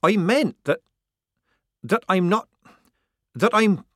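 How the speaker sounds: high, loud, halting restarts; flustered stammer. A narrator's reading. Disguised girl struggling to explain herself, flustered stammer. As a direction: blurt and break off, flustered.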